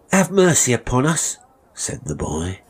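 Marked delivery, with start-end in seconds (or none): 0.1-1.4 high, loud, desperate plea; pleading boy. Acted. Boy begging his master for mercy, desperate. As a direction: beg for mercy in a raised boyish voice.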